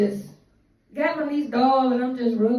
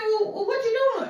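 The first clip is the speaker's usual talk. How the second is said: much higher, puzzled auntie; auntie's voice; 11 semitones higher than usual. Retold or imitated. Aunt asking what she is doing, high puzzled voice. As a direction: ask it pitched high, bemused. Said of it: "She said".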